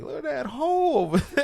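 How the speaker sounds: put-on very high and loud hillbilly Southern accent; goofy Southern guy. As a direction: squeal it like an awestruck Southern yokel. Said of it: "a guy's head from the South and be like".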